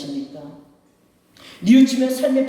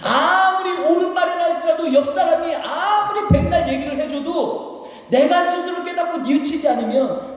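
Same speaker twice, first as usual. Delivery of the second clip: high, stretched-out preacher's delivery; forceful preaching; 10 semitones higher than usual. Real, not acted. Pastor telling the congregation advice alone fails, forceful preaching. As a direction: drive it home from the pulpit, pitched high.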